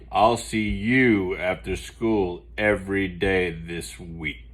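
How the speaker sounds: lightly voiced stern teacher; a hint of a strict teacher. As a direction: hand down the punishment evenly. Teacher Ratburn hands detention.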